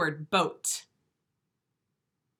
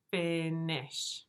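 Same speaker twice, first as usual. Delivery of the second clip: syllables drawn out; 3 semitones lower than usual. Shown as a demonstration. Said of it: "Two syllables".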